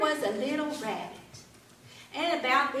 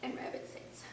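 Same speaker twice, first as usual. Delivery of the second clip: ordinary delivery, nothing marked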